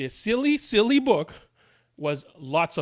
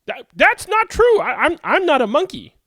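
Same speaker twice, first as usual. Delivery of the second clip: high flustered denial; protest, rattled and high; indignant protest; 11 semitones higher, 6 dB louder than usual. Acted. Protesting being called a monkey.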